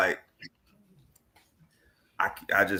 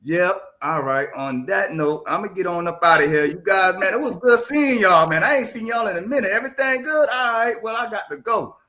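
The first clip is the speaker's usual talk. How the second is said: cheery exit; 6 semitones higher, 3 dB louder than usual. Shown as a demonstration. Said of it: "Just be like".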